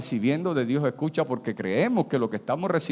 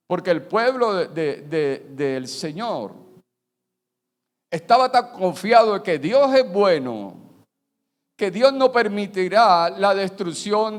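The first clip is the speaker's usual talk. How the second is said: loud, high, slowed preaching; fervent sermon climax; fiery preaching; 7 semitones higher, 6 dB louder than usual. Real, not acted. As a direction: preach it with full force. Preaching at full force.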